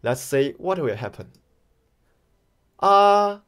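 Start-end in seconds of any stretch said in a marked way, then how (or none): 2.8-3.4 AH held high and level; long, high, unbending AH. Shown as a demonstration. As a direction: sustain AH on one high, level pitch. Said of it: "call it as a high tone".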